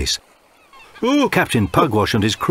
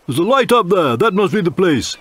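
much higher, louder captain's voice; pirate captain; 12 semitones higher, 4 dB louder than usual. Acted. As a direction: spot the signal with bluster. Narrator voices Pugwash.

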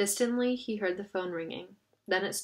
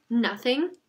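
louder question, touched with panic; edging toward panic; 3 semitones higher, 6 dB louder than usual. A narrator's reading. Jonah asking if nothing at all is left, edging toward panic. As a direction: push the word up in mild panic. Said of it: "his own voice edging toward panic".

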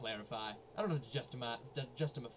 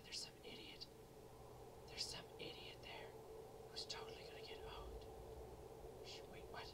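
soft unvoiced whisper, quicker at the end; 14 dB quieter than usual. Real, not acted. Gamer sneaking past an enemy, whispering. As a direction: breathe it so the enemy can't hear.

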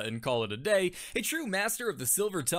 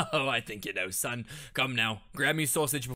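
hammed-up Cockney-ish accent from a big man; leering London dock bloke, lightly accented; mustachioed Englishman; 5 semitones lower, 4 dB louder than usual. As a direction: lean into a leering Cockney geezer. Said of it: "a large mustachioed man".

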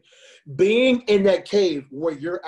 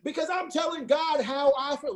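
high, fired-up preacher voice; impassioned sermon peak; passionate preaching; 11 semitones higher than usual. Real, not acted. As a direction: preach it with real passion, pitch way up. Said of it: "Listen, I'm preaching right now".

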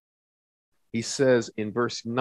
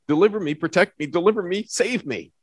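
fast and higher, tumbling quick pleas; rapid urgent plea; 8 semitones higher, 3 dB louder, faster than usual. Shown as a demonstration. Preacher to congregation showing David's quick prayers, fast and urgent. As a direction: fire them off like quick popcorn prayers. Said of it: "Those, those four prayers, right?".

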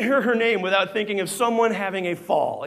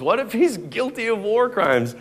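usual speaking voice

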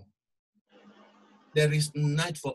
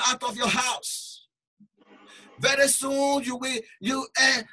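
loud, high preaching cry; forceful preaching; 8 semitones higher, 5 dB louder than usual. Acted. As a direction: drive the warning home.

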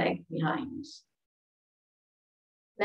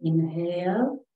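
calm, soothing relaxation voice with long pauses; guided meditation; 2 semitones lower than usual. Real, not acted. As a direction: guide them gently through each breath, leaving space. Leads breathing and meditation.

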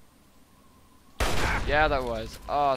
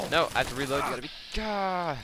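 frantic, higher and louder; panicked; 3 semitones higher, 6 dB louder, much faster than usual. Real, not acted. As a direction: scramble to reload in a panic. Reloading mid-fight, panicking.

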